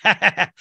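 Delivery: imitated crook's cackle; mock laugh. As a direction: fake a gleeful laugh.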